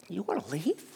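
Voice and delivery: dropped to a soft, stunned question; hushed disbelief. Retold or imitated. Peter asking Jesus quietly, stunned. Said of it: "Can't you just hear Peter say".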